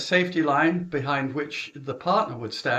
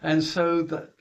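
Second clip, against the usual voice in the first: usual speaking voice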